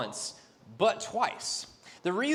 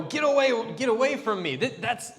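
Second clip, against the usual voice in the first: sharp, much higher and louder; angry denial; 6 semitones higher, 6 dB louder than usual. Retold or imitated. Peter angrily denies knowing Jesus to the lady, shouting. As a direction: push her away angrily. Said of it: "angrily detests knowing Jesus".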